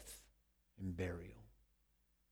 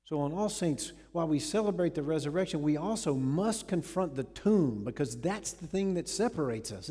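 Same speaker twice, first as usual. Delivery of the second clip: full-force preaching; 3 semitones higher, 6 dB louder than usual. Acted. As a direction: build the sermon to a climax.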